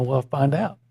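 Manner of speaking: ordinary delivery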